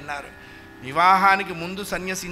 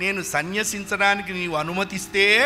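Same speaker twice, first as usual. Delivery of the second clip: ordinary talk, nothing marked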